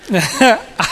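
short laugh at himself; amused laugh. Real, not acted. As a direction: laugh at your own shopping. Laughing at own story.

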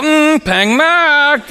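a slow, doubtful "pang mak" at the market stall; drawn-out mock haggle. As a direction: stretch it out like a tough haggler. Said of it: "To which I go".

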